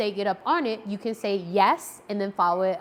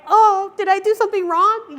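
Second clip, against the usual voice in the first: worried dog; 10 semitones higher, 8 dB louder than usual. Pretend. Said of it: "they're like".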